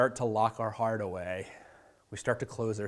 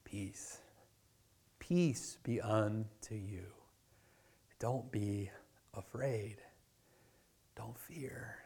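hushed, slow and soft, fading to a whisper; calm frightened friends in a near whisper; tender reassuring whisper; gentle whisper; 9 dB quieter, much slower than usual. Pretend. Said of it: "Jesus' immediate words to them".

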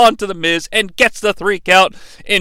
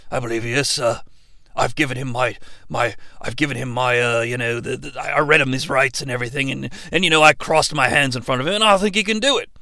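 low exorcist voice; 9 semitones lower, 7 dB quieter, faster than usual. Pretend. Crowe character answers, very low.

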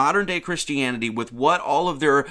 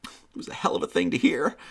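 mock tearful voice, soft and choked; Jordan Peterson; 5 dB quieter than usual. Streamer mocking a man's tearful story, imitated crying. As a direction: imitate a man quietly breaking into tears. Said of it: "he starts crying".

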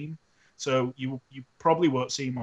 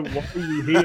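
laughing mock question; 2 semitones higher than usual. Imagined kids mocking him.

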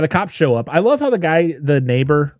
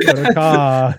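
put-on loud, higher New York accent on "car"; mimicked dropped-R accent; 5 semitones higher, 6 dB louder, slower than usual. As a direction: punch out "car" in a mock R-less accent. Riffs on car-park accent.

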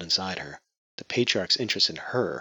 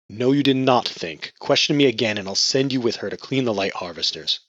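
angry; 4 semitones higher, 5 dB louder than usual. Acted. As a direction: threaten her angrily. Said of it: "angry crimson".

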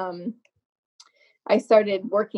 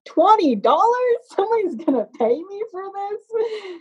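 much higher, amazed double-take; disbelieving surprise; 12 semitones higher than usual. Retold or imitated. Offered twenty dollars for makeup, stunned high delight. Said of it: "And I said".

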